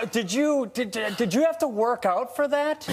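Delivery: high-pitched mimicked fan question; curious fan. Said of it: "all the time they ask me".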